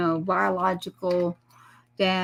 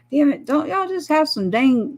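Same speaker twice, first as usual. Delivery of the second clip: frustrated outburst; 7 semitones higher than usual. Retold or imitated. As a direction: voice a stressed young soldier losing patience at the counter. Stressed son snaps ordering coffee.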